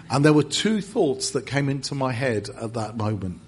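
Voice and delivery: usual speaking voice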